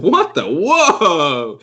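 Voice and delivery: high, swooping mock astonishment; hammed-up disbelief; startled exclamation. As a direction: blurt it high, then a drawn-out whoa. Acting out a startled reaction.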